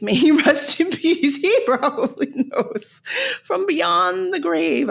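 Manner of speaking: laughing through the words. Real, not acted. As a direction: giggle while saying it. Joking about dead dad.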